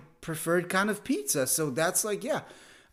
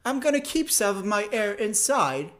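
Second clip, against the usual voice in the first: mock arrogant Brit; 7 semitones higher, 5 dB louder than usual. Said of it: "it feels arrogant that they're like".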